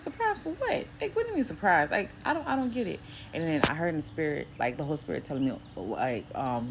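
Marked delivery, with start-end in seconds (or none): 0.0-3.0 high-pitched, puzzled questioning; puzzled protest, pitched way up; retold bafflement. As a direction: act out being thrown by a baffling message. Retold puzzled reply to spirit.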